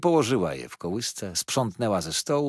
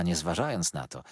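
ordinary delivery

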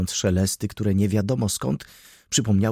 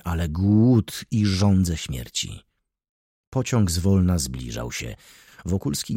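ordinary delivery

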